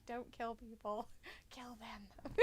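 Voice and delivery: whispered inner voice. Pretend. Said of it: "I'd be like".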